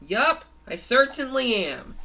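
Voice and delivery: loud, high cartoon reply; cheery confirmation. Revived Forky replies to Fangy, cheery confirmation.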